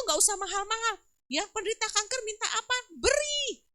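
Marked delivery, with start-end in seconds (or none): 3.0-3.6 high, loud, insistent order; emphatic command. Real, not acted. Preacher urging church to give to cancer patients, emphatic command. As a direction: punch it out high, urging them to act. Preacher urges giving, answering own question.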